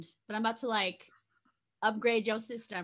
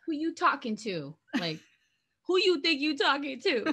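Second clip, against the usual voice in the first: louder, laughing mock challenge; heated confrontation; 4 semitones higher, 4 dB louder than usual. Ex confronting someone, laughing mock challenge. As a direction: square up, half laughing. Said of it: "He would've been like".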